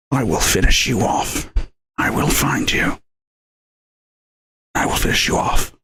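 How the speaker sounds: low, husky threatening impression; badly faked movie-hero threat, low and voiceless; Liam Neeson. Shown as a demonstration. Showing off accents with a threat, bad low impression. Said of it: "the worst Liam Neeson impression".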